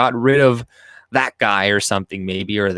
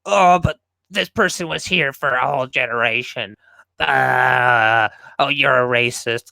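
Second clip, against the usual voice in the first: mocking whine; 3 semitones higher than usual. Mocking opponents' argument.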